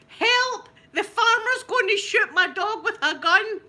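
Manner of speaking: high, loud frantic yell through laughter; shouting. Retold or imitated. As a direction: scream for help to save the dog. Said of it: "and I'm shouting".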